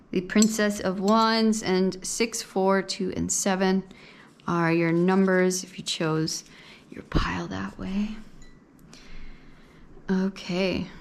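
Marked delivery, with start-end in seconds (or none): 5.6-8.1 soft whispered aside. Real, not acted. Tarot reader explaining pile choice to viewers, whispering. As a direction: drop to a hushed whisper. Whispering while handling cards.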